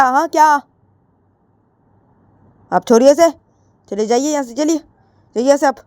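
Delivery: angry defiance. Acted. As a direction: yell at her mother to leave. Jiya argues with mother.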